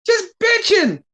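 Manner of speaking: Just bitching squeaked up high in disbelief; exasperated. Real, not acted. Fan repeating a complaint about the champ, squeaked high in disbelief.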